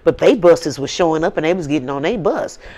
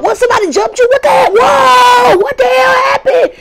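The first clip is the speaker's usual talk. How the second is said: very high, loud shocked shriek; shrieking sisters; 8 dB louder than usual. Retold or imitated.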